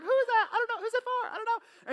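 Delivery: high-pitched mock chatter; imitated gossiping women. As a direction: play excited women asking each other, voice way up. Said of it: "you know how women do".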